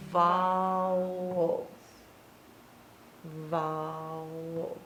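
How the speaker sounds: "vowels" held long on the ow; stretched out. Shown as a demonstration. Teacher sounding out syllables for a pupil, stretched out. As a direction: draw out each "vowels" to show its vowel sound. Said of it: "a syllable has to have a vowel".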